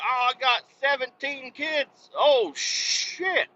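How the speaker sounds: high, drawn-out voice; hammed-up high exclamation; acted phone-call exchange. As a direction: stretch it out high, both boast and reaction. Acted friend's news and reaction.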